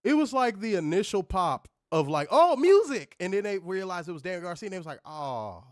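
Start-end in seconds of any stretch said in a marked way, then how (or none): 2.3-3.1 mock crowd excitement. Mocking the crowd's cheer. 5.1-5.6 deflated crowd. Retold or imitated. Mocking crowd's letdown.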